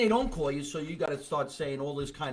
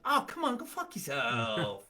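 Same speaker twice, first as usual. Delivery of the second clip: joking; 3 dB quieter than usual. Shown as a demonstration. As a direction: brush them off playfully, drawing it out. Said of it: "When I really meant".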